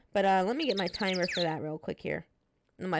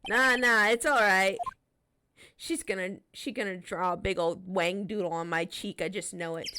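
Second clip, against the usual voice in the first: Nick character voice. Acted. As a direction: grumble it like the put-upon straight man, pitched down. Streamer voicing Nick in game.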